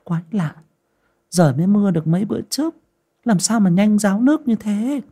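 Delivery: a maid wondering aloud, fast and high; lightly voiced servant girl, baffled; puzzled girl's muttering. A narrator's reading. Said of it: "Con Ngân lẩm bẩm".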